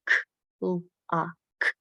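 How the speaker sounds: "K, u, a, k" as separate sounds with gaps; "K, u, a, k" broken apart for blending; segmented sounds. Shown as a demonstration. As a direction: say "K", "u", "a", "k" one isolated sound at a time. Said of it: "hear ha-, the word segmented".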